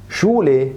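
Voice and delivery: "شولي" with a plain sh; German sh sound. Shown as a demonstration. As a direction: say "شولي" as shule, ordinary sh. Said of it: "زي كلمة شولي".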